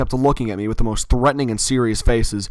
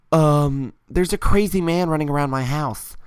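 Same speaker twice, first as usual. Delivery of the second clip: higher-pitched imitation of a kid fibbing; lightly voiced kid on the phone; cousin's put-on phone voice; 4 semitones higher than usual. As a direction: act out a child making up an emergency call. Cousin's quick excuse to 911.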